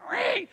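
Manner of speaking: short high-pitched ringing noise; sudden high "ring" for a slipping tool; imitated ratchet slip. Telling how the ratchet slipped, high imitated noise.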